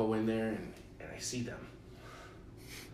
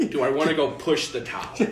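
higher, much louder mocking echo; incredulous echo; 5 semitones higher, 10 dB louder than usual. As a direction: throw the question back in disbelief. Incredulous echo of dad's offer.

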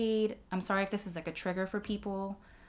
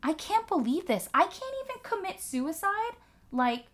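much higher, incredulous put-on voice; exasperated disbelief; 7 semitones higher than usual. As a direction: throw up your hands in mock outrage. Her own exasperated inner reaction.

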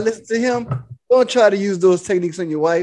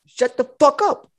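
mock pimp command, pitched up; higher, drawn-out retold order; Iceberg Slim; 5 semitones higher than usual. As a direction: act out a slick pimp silencing someone. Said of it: "Iceberg Slim just got".

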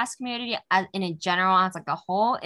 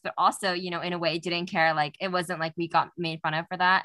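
ordinary delivery

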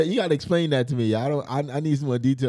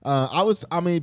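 ordinary delivery

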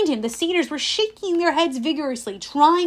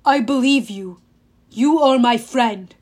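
put-on earnest, childlike monster; simple, trusting giant; 7 dB louder than usual. A narrator's reading. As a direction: declare friendship word by word. Trusting monster answers his friend.

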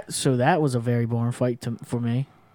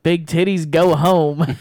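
raised voice, imitating a mic yell; mock scream; 3 semitones higher, 5 dB louder, slower than usual. Fighter taunting opponent on the mic, mock scream. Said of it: "he screamed it in the microphone".

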